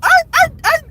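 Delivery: high-pitched, tearful outpouring; real frustrated, tearful upset. Real, not acted. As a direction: plead your case through real tears. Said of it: "I'm so frustrated".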